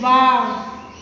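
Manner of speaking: drawn-out demonstration wow; mildly exclaimed example; sudden feeling. Shown as a demonstration. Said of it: "expresses the sudden feeling".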